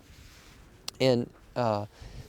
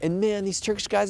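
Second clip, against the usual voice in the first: normal talking voice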